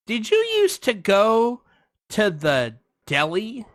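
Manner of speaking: slow, high-starting imitation question; a hint of a nosy woman, drawn out; lightly voiced chatty stranger. Woman questioning stranger in line, slow mock inquiry.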